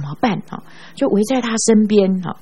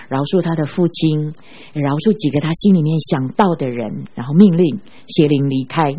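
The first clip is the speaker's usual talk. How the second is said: ordinary delivery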